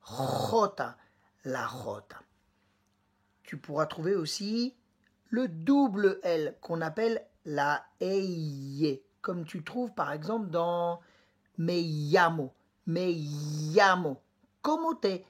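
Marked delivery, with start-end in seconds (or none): 0.1-2.2 jota with a rasped throaty J; Spanish jota from the throat. Shown as a demonstration. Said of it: "on se racle la gorge". 11.6-14.2 llamo with the LL said like a French Y, yamo; Spanish double L. Shown as a demonstration. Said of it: "c'est comme un Y".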